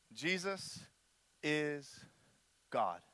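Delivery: slow, hushed, one word at a time; quiet, spaced-out solemn statement; deliberate pronouncement. Retold or imitated. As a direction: let each word stand alone between long pauses. Re-enacts a teacher's three-word lesson.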